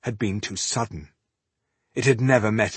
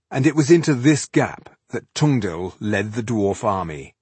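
plain reading voice

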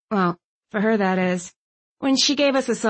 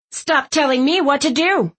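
louder, higher furious snap; angry outburst; 6 semitones higher, 4 dB louder than usual. A narrator's reading. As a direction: blow up at her. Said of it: "Mom exploded".

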